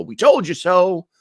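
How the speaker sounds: high, sing-out gloat; high-pitched mock "told you so" gloat. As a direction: crow it like a taunting winner. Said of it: "start to gloat and go".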